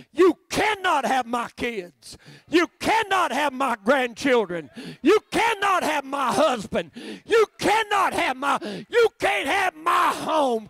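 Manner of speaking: defiant to the devil. Acted. Preacher defying the devil over family, slow shouting. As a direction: stand your ground and shout him down.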